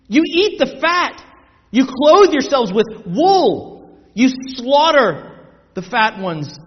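forceful, measured indictment; drawn-out emphatic scripture reading; preaching scripture. A narrator's reading. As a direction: preach it slowly, punching each accusation.

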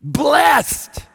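BLESSED cried out high and loud; Blessed launched far above the talking pitch; emphatic exclamation. Shown as a demonstration. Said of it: "it would read fairly woodenly".

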